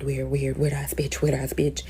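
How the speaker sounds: hushed, repetitive mock complaint; retold muttering. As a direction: grumble it quietly, as if overheard on the phone. Overheard muttering about her on phone.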